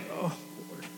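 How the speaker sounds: hushed, airy groan of a prayer; mock crushed. Teenager back before God after sinning again, mock crushed. Acted repeat of guilty prayer.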